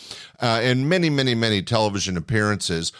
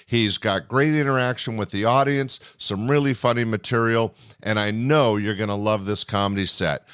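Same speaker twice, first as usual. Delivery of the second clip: ordinary delivery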